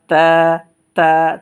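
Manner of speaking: তা in a thin voice, tongue tip on the teeth, the first loud; thin light তা, one beat long. Shown as a demonstration. Teacher to students, a thin তা. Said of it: "চিকন আওয়াজে পড়ব".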